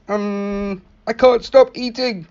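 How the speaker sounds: high, squeaky little caterpillar, loud at the end; a greedy caterpillar who can't stop munching. Acted. Narrator voicing the caterpillar's message for Iggy, high little bug voice. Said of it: "It says".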